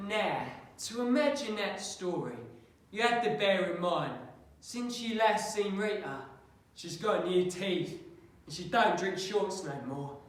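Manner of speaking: Patsy the storyteller, building from matter-of-fact to emphatic. Acted. Patsy professing manly love to Mister West, emphatic in character.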